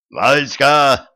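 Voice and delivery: loud call across the room; yell. A narrator's reading. Dog Zhuchok yells to the cat during the fight. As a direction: shout his name to alert him. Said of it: "заорал жучок".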